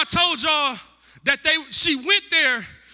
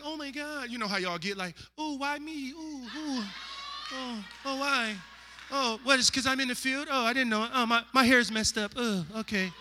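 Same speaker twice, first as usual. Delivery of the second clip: much softer, breathy-free hushed fluster; soft flustered mock; 9 dB quieter than usual. Ruth startled by Boaz's attention, hushed and coy. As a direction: play it coy and flustered, very quiet. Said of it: "she's like".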